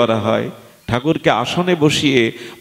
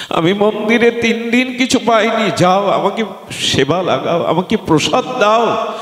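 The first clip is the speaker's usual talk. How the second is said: high-pitched, urgent plea of a god; declaimed divine demand for food; hungry deity demanding; 11 semitones higher than usual. Acted.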